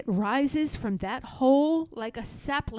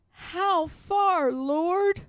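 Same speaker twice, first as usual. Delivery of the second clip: higher, tired asking voice; a worn-out plea; weary Harriet; 7 semitones higher, much slower than usual. Acted. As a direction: ask the Lord how much further. Weary Harriet asks God.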